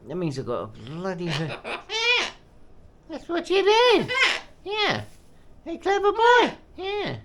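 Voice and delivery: squeaky sing-song praise for the bird. Real, not acted. Cooing at pet bird.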